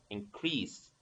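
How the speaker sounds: low, soft "increase", weight on "crease"; stress on second syllable. Shown as a demonstration. Teacher repeats the verb, "increase" stressed on its second syllable. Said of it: "the stress in the second syllable".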